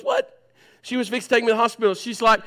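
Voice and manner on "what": shocked wife. Retold or imitated. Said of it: "She goes".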